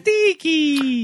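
high, drawn-out mock name; high teasing singsong on Dickie; sing-song Dickie. Mocking the name.

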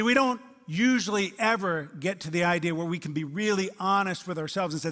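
usual speaking voice

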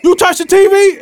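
high, loud accusing shout; angry confrontation. Retold or imitated. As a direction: call out one guy at the party. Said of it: "and I'm like".

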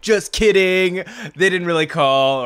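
loud, drawn-out gotcha; teasing prankster. Retold or imitated. Host imitating the friend who pranks him, teasing and loud.

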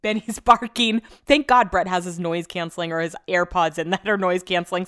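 usual speaking voice

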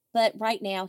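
normal talking voice

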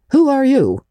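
put-on sharp high demand from a stern old woman; demanding old lady. A narrator's reading. Old lady demands who the girl is, sharply. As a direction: snap the question out like an old aunt. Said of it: "demanded Miss Josephine Barry".

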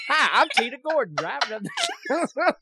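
high-pitched ad spokeswoman impression; Tina Gordon. Imitating a remembered local advertiser, high voice. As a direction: do a high local-commercial intro. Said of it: "Tina Gordon and Sticks and Stuff".

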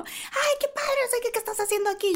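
mock girlish squeal; excited girls. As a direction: gush like thrilled fans. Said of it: "súper emocionadas".

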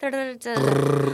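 slow rolled tongue trill. Shown as a demonstration. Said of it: "你们有谁会弹舌吗？".

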